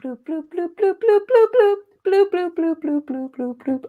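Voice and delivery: high, bouncy bloops sung with the mouth; mouth-made fart song. Shown as a demonstration. Podcaster making a fart song with his mouth, high bloops. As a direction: make a fart beat with your lips. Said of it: "recreating them with my mouth".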